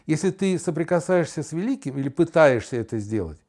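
ordinary delivery

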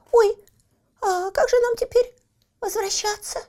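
high tearful little voice; frightened crying. Acted. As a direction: whimper in fear. Застряли на горе, испуг. (испуганный плач)

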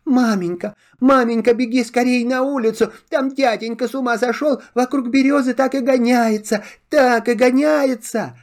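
put-on high, fast, scared child voices; frightened children. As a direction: blurt it out as frightened little boys. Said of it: "заплакали со страху, кричат".

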